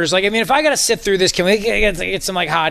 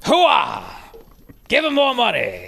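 lighter Hooah, less shouted; Al Pacino impression; 3 semitones higher, slower than usual. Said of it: "Al Pacino".

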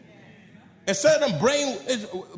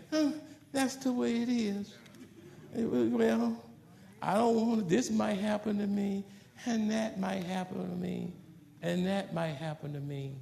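timid excuses; 9 dB quieter than usual. Retold or imitated. Imitating timid excuse-maker.

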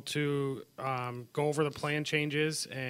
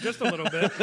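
laughing it off, embarrassed; 8 semitones higher, 5 dB louder than usual. Real, not acted. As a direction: burst out laughing at yourself. Embarrassed admission, big laugh.

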